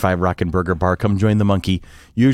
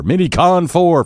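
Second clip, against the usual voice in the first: announcer hype; 6 semitones higher, slower than usual. Acted. Hyping the event number.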